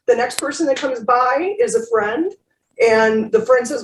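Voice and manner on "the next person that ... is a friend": usual speaking voice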